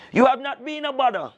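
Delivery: high sly voice of the alligator greeting his guest. Acted. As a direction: purr the greeting like a crafty alligator. Alligator speaks slyly.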